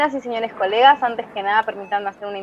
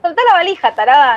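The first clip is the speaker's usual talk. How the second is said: higher, louder, yelling let go; angry yell; 4 semitones higher, 4 dB louder than usual. Acted. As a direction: fight over the suitcase. Sisters fighting over suitcase.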